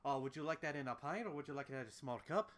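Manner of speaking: bartender's improvised Scottish-Irish brogue; Scottish-Irish accent. Acted. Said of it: "Scottish-Irish accent".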